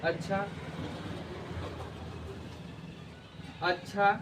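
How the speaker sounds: अच्छा said with a short rising question tune; questioning acchha. Shown as a demonstration. Teacher demonstrating question intonation, अच्छा rising. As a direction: ask अच्छा as a doubtful question, rising at the end. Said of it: "question marks वाला".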